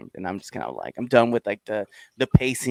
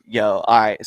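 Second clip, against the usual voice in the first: normal talking voice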